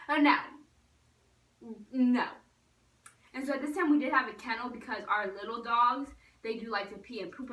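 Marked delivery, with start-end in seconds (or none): 0.1-2.3 loud, emphatic no; firm mock refusal. Girl to dog on the couch, loud firm refusal. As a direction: drop each refusal hard, with a pause between.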